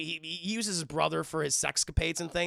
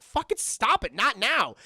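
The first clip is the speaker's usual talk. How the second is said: higher, louder mock scolding; acted protest; 6 semitones higher, 7 dB louder, slower than usual. Girlfriend fending off boyfriend at dinner, urgent protest. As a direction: shoo off a pest in a put-on girlfriend voice.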